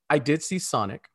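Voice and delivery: usual speaking voice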